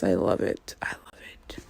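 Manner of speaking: breathy whispered delight; whispering. Real, not acted. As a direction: whisper it to yourself.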